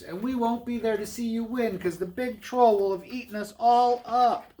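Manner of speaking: high-pitched worried goat voice; high storybook pleading; afraid little goats. Acted. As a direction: plead as the frightened little brothers. Frightened brothers plead.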